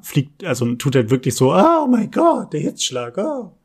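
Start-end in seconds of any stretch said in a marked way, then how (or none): 1.5-3.5 over-the-top dying wail, much higher; dying swan. Pretend. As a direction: ham up a theatrical death. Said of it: "den sterbenden Schwan". (sterbender Schwan, übertrieben und hoch gejammert)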